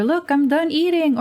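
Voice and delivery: put-on high proud little kid voice; child voice. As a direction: announce it like a small child. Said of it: "It's either".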